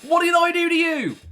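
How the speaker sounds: high, loud indignant yell; loud outraged driver; mock yell. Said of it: "And they're yelling".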